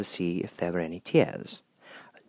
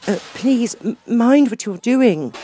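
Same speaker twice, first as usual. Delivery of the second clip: much higher, louder, slow panicked plea; terrified cry; 13 semitones higher, 7 dB louder, slower than usual. A narrator's reading. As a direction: beg in terror as pans fly. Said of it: "cried Alice, jumping up and down in agony of terror".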